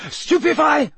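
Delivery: loud, high-pitched shout; sharp high cry of attack; yelled spell. A narrator's reading. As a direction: yell the spell at a fleeing foe. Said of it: "Harry yelled".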